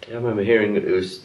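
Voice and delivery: ordinary delivery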